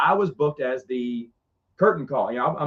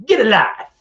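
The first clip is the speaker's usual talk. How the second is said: higher, louder punchline jab; mock loud retort; 6 semitones higher, 7 dB louder than usual. Speaker turning Matt around on stage, a loud mock retort. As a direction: fire it back as the punchline. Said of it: "He turns me around".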